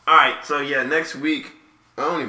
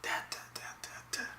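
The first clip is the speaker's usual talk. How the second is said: breathy whispered repetition; 20 dB quieter than usual. Acted. Whispering to the listeners, trailing away in a whisper. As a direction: let each word fade to a whisper.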